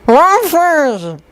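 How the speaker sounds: garbled through a mouthful; mouth full. A narrator's reading. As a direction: say it through food. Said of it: "Connor spoke through his food".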